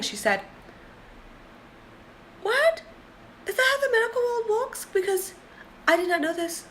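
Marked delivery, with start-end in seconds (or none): 2.4-6.6 much higher, near tears; shocked disbelief. Real, not acted. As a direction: cry out in real disbelief. Shocked by the billing practice.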